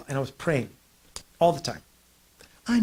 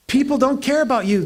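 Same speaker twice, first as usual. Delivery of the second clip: ordinary delivery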